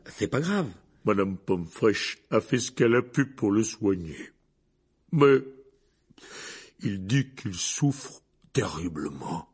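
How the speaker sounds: slow, slurred, downcast Hagrid voice; a weary tipsy giant grumbling; drunk gloomy giant. A narrator's reading.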